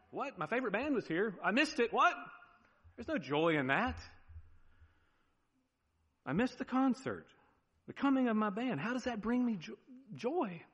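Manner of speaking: high-pitched mock disbelief; incredulous fan. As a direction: play a baffled, protesting concert-goer. Plays fan who missed concert.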